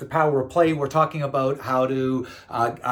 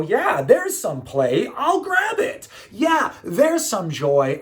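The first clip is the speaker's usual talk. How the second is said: loud, high-pitched burst of enthusiasm; eager delight; 8 semitones higher, 5 dB louder, much slower than usual. Acted. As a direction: pounce on it with drawn-out, gleeful emphasis. Acts out eagerly seizing play and joy.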